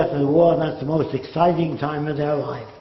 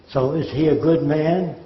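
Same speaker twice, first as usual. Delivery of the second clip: usual speaking voice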